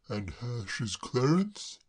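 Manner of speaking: a hint of a puzzled gentleman; mildly baffled; lightly voiced Braddock. Braddock puzzled by Claire, mildly baffled.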